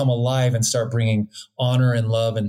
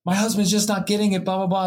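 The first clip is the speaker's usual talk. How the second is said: higher whiny complaint; mock wife complaining; 7 semitones higher, faster than usual. Husband mimics a wife complaining to the doctor, higher and whiny. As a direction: whine it like a fed-up wife. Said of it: "it's like".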